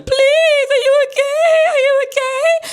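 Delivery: very high, loud wail; imitated panicked woman, words stretched high; mock hysterical mum. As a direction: cry out over him, hammed up. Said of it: "The mum's there wrestling".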